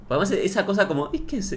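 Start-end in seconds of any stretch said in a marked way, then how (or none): 1.1-1.5 much higher, soft, mock curious little voice; vocecita de interés. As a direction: ape the fake-interested squeak. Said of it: "Estás en esa vocecita". (vocecita aguda y suave de falso interés)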